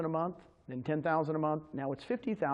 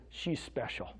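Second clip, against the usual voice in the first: tearful quote; 5 semitones lower, 6 dB quieter, slower than usual. Retold or imitated. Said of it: "tears out, he goes".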